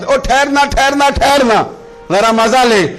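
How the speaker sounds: calling out stop. Acted. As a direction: shout from behind to halt. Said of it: "دیکھنے والا آواز". (پیچھے سے پکار کر روکنا)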